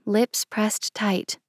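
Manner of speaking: usual speaking voice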